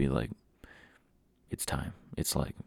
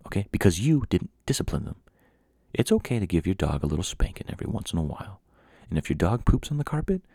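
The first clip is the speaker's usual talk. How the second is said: ordinary delivery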